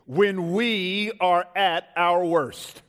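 slow, loud sermon headline; drawn-out, punched key line; emphatic preaching. Real, not acted. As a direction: proclaim it slowly from the pulpit. States sermon in a sentence.

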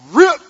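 loud, high mock rip noise; father ripping the check in front of his kids, loud mock rip; ripping sound. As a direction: voice the tearing of paper. Tearing up the check.

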